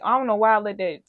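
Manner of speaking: ordinary talk, nothing marked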